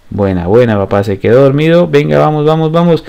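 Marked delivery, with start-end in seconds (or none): none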